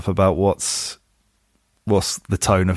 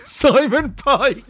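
laughing; much slower than usual. Real, not acted. Laughing at the rhyme in the poem.

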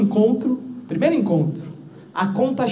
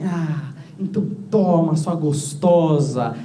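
lightly hammed-up bedroom voice, slow and low; slow, low mock seduction; 6 semitones lower, much slower than usual. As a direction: drag it out like a cheesy seducer. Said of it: "eu virei pra ela e falei assim". (sedução exagerada, lenta e grave)

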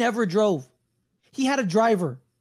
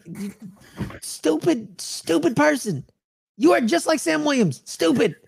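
exasperated insult; 7 semitones higher, slower than usual. Real, not acted.

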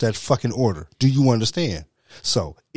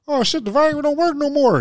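put-on high whining old man; imitating a panicked old man, much higher; whiny mock complaint; 6 dB louder than usual. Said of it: "Talking about some".

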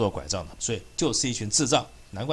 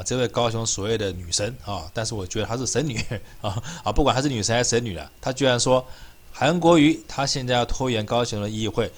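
normal talking voice

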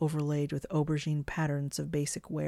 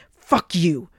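much louder, explosive interruption; furious shout; 3 semitones higher, 11 dB louder than usual. A narrator's reading. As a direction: shout them down in fury. Enraged Morg cuts Shalott off.

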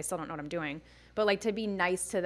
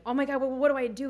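mock panic; 6 semitones higher, 5 dB louder, faster than usual. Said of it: "And I'm like".

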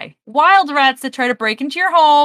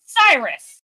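normal talking voice

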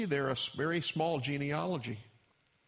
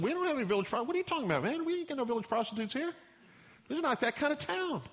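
much higher, fast hammed-up local voice; indignant villagers; 11 semitones higher, much faster than usual. Townsfolk tell the servant there's no prostitute here, hammed-up high and fast. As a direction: squeal it out like an offended townsman.

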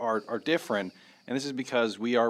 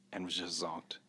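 whispering; 7 semitones lower, 13 dB quieter than usual. Real, not acted. Describing sleeping friend.

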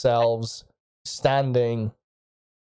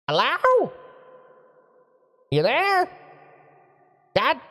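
high, booming mage voice from afar; a mage's voice echoing through the room; 11 semitones higher than usual. Acted. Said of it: "this booming, booming voice".

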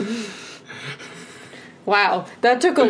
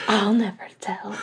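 sly honeyed whisper. Pretend. Continues the mock secret.

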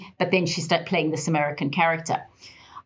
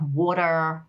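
American WATER, "wah-derr"; Americanized pronunciation. Shown as a demonstration. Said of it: "you have to Americanize certain things".

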